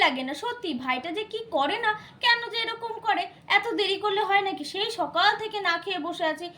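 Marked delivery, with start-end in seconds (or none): none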